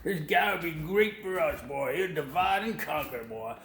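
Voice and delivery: put-on Southern whoop, pitched well up; fake Southern accent. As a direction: ham up a Southern Klansman voice. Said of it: "maybe a little fake Southern accent".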